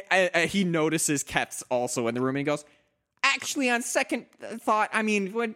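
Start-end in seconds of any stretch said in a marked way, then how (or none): 3.2-5.5 high, nervous backpedal; timid backpedaling. Acted. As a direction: quickly take it back, pitched up. Spike backpedals in character.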